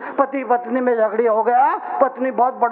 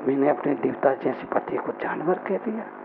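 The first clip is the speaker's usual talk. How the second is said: regretful; 9 semitones lower, 6 dB quieter than usual. Acted. Wife regrets calling husband animal.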